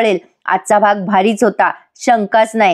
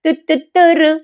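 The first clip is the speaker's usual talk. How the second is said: imitating a tune with the mouth, pitched up; mouth music; 6 semitones higher than usual. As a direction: hum the tune with "तु तु तुरु". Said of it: "music पण वाजवत आहेत बरं का तोंडानी". (तोंडाने वाजवलेलं संगीत)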